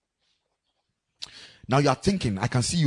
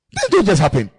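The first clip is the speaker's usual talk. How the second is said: emphatic point; 4 semitones higher, 6 dB louder than usual. Real, not acted.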